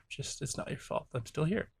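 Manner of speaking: soft reassurance. Retold or imitated. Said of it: "there was a part of him that says".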